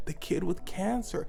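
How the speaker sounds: normal talking voice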